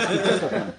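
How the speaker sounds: threat said through laughter; laughing threat. Retold or imitated. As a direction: laugh while retelling the threat. Retelling the pilgrim's threat, laughing. (menace racontée en riant)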